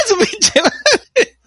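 cursing through laughter; slow, stretched laughing curse. Real, not acted. Laughing at retold blunder.

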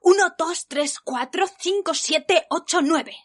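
eager loud counting; boss counting proudly. Retold or imitated. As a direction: show off the new numbers. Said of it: "venía y decía".

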